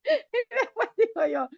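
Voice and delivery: laughing. Real, not acted. Still laughing while telling.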